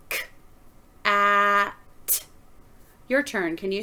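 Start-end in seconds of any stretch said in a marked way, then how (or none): 0.1-2.2 "K, a, t." each sound said apart with pauses; sounding out words. Shown as a demonstration. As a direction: sound out "K, a, t." slowly, one sound at a time. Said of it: "Listen while I sound out the word cat".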